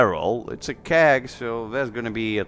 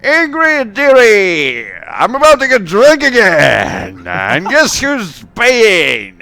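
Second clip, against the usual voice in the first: high, full-volume tavern holler; loud, high-pitched drunkard's announcement; boisterous shouting; 10 semitones higher, 12 dB louder, slower than usual. Acted. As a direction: bellow cheerfully across the tavern, stretching the last words. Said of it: "he starts shouting".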